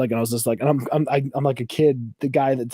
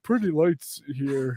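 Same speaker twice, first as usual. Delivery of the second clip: dazed Foley; 3 semitones higher, much slower than usual. Retold or imitated. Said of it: "he's probably like".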